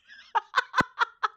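giggling burst of high laughter; bright high giggle. Real, not acted. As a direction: laugh out loud.

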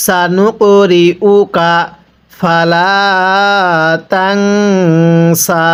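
slow drawn-out tajweed chant; Quran recitation. Shown as a demonstration. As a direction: stretch every vowel like a teacher reciting. Recites the verse.

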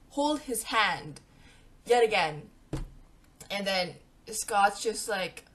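ordinary talk

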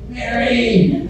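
a high called-out name, half-shouted; retold yell for help. Husband stuck at the computer calls his wife, high mock yell. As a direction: call your wife from the next room, high and sharp. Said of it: "is yell".

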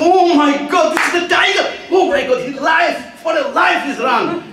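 high, loud cry of terror, half laughing; panicked shout. Retold or imitated. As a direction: scream it as you realise it's a tiger and run. Washerman discovers it's a tiger.

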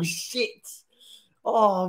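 usual speaking voice

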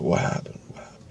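urgent hushed whisper. Retold or imitated. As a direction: hush it urgently to a friend. Quietly asking friend what happened.

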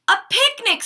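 high, bright happy exclaim; child thrilled at an indoor picnic; delighted. Acted. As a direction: gasp it out with joy.

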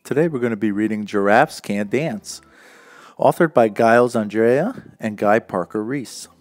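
ordinary delivery

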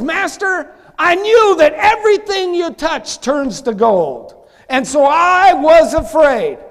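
loud, high preaching cry; impassioned servant's plea. Acted. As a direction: cry out to the master, drawing out the last words. Acts servant pleading to master.

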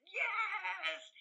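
high, bright burst of agreement; a jumped-up shout of yes; eager yes. Retold or imitated. Ad agency man eagerly agreeing to the publicity stunt, excited yes.

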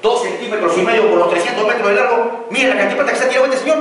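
high, loud and fast tirade; angry rant. Retold or imitated. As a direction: imitate the architect furiously listing the waste. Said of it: "me dice".

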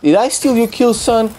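high, excited gloating taunt. Real, not acted. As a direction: rub the stolen kill in his face. Taunting an enemy after a kill.

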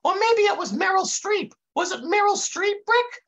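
very high, eager sidekick voice; Dummerton. A narrator's reading. Interrupting Brick with a guess, high eager voice. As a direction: butt in with a high goofy voice. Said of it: "said Dummerton".

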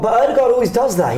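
ordinary delivery

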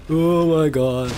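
panicked scream. Real, not acted. Chased by monster in game.

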